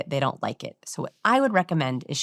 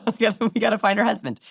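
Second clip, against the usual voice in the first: laughing; 4 semitones higher, much faster than usual. Real, not acted. Laughing excited determination.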